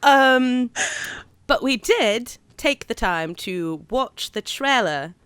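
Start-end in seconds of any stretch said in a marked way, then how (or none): 1.5-5.1 bad London accent. Host introducing a film trailer to co-host, bad London accent. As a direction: draw out an amateurish London accent. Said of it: "talk in a bad accent".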